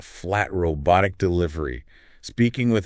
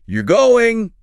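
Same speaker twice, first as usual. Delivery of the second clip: "You're going" much higher and louder, falling hard on "going"; emphatic surprise; 9 dB louder than usual. Shown as a demonstration. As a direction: punch "going" in disbelief. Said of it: "with emphasis, falling tone".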